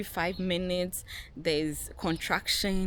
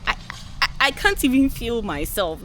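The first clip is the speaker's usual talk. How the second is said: much louder, high yelling back; furious retort; 7 semitones higher, 10 dB louder than usual. Retold or imitated. Wife in labor snapping at husband, yelling furiously. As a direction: yell at him in disbelief.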